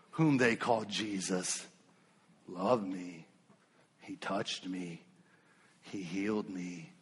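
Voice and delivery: low, soft and slow; hushed testimony. Acted. Preacher giving the healed man's quiet testimony to the church, hushed. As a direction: let it sink to a tender hush.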